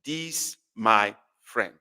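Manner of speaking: "This my friend." drawn out slowly with weight on my; teacher spelling out the meaning slowly; slow, stressed this my friend. Shown as a demonstration. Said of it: "That means".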